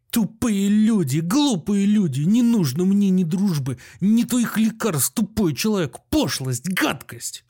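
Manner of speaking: high, drawn-out outraged insults; angry shouting. A narrator's reading. Doctor rants at his departing guests, furious. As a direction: rail at them, dragging out each insult. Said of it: "продолжил кричать Андрей Ефимыч".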